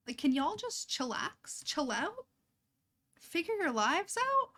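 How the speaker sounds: drawn-out, rising in disbelief; fed up, spell it out slowly; climbing exasperation, slow and high at the end; exasperated. Real, not acted. Exasperated at the game's men.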